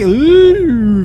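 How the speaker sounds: high, loud drawn-out ooh; playful ooh. Pretend. Driver whooping on reaching Vegas, playful high ooh.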